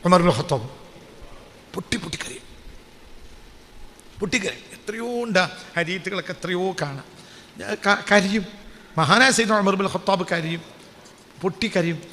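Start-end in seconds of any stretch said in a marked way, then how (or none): 1.7-12.0 dropped to a soft, moved hush. Real, not acted. Preacher telling of Umar's weeping, soft and hushed. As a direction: lower the voice as if moved to tears.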